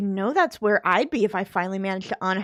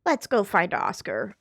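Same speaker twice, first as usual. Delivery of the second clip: usual speaking voice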